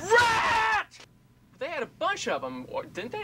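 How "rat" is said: sudden high, loud yell. Retold or imitated. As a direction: shout the word out like a warning. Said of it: "Did you already get Carla yell rat?".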